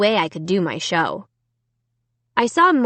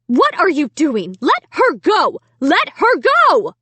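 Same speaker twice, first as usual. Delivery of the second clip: high, louder frantic demand; frantic mother; 7 semitones higher, 4 dB louder, slower than usual. A narrator's reading. Mother to the girl, frantic and higher. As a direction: pull at her arm in panic. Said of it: "frantically tugging my arm".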